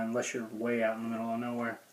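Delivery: usual speaking voice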